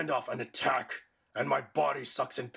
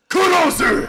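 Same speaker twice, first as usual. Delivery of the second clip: high, very loud attack call; attack shout; 14 dB louder than usual. Acted. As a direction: roar the move name. Villain calls his attack.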